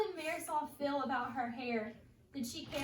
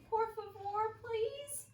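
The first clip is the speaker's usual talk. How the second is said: high begging por favor, please climbing higher; pleading please; 8 semitones higher, slower than usual. Shown as a demonstration. Teacher showing kids how to beg their parents, high pleading. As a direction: beg sweetly like a child. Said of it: "look at your parents and say".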